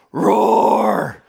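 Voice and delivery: loud, high mock roar like a little boy; playful roar. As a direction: roar right into grandpa's face like a toddler. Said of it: "and he goes".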